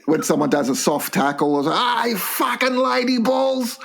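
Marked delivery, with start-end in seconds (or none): 1.7-3.8 high, drawn-out terrace jeer; mock football heckle. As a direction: jeer it slowly in a raised pitch. Heckles a soft tackle at football.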